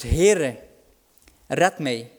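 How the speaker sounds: loud pleading call; crying out. Acted. Peter sinking, calling out loudly to Jesus. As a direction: cry out to the Lord. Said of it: "die uitroept".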